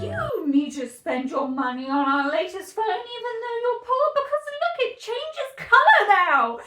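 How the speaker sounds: high, over-eager salesy conference pitch; scummy tech pitchman. Acted. Mocking a big tech company pitching phones to the public, scummy and high. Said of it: "I want it to sound scummy".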